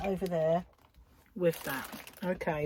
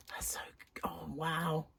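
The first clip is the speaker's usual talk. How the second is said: soft whisper of delight; awed whisper; 2 semitones lower, 10 dB quieter than usual. Real, not acted. Crafter admiring new yarn, whispering in awe. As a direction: breathe it out like a secret.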